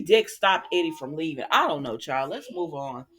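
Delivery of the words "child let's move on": ordinary talk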